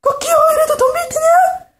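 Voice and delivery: very high, loud rooster crow into a taunt. Acted. Rooster attacks pigman.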